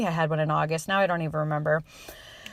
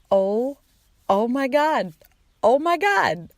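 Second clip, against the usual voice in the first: rising shock; 5 semitones higher, slower than usual. Retold or imitated. Reacting to a positive test.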